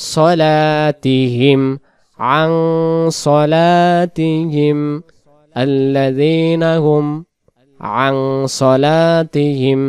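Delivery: very slow stretched tajweed chant; slow melodic verse recitation; Quran recitation. Shown as a demonstration. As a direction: draw out the nasal ending melodically. Recites the phrase repeatedly.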